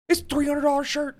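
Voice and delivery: high, put-on appraiser voice; mock appraiser. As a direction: hype the price like a TV appraiser. Appraiser-style mock valuation.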